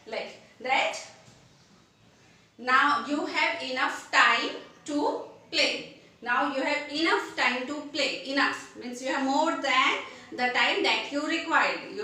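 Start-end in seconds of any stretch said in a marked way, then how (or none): 2.6-6.0 slow, deliberate example sentence; measured classroom demo, word by word; slow example read. Shown as a demonstration. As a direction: spell it out slowly for learners. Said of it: "teacher demonstrates example sentence".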